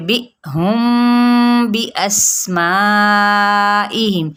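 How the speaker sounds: slow chanted بی اسمائہم with a clear whistling seen; Quranic recitation. Shown as a demonstration. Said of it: "سین کی سیٹی کو واضح کریں گے". (سین کی سیٹی واضح کرتے ہوئے آہستہ تلاوت)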